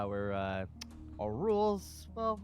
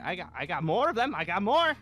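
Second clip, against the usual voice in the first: louder, higher, rising in force; heated boast; 6 semitones higher, 8 dB louder than usual. Acted. Leader boasting of more guns to a challenger, heated. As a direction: throw it out as a loud threat.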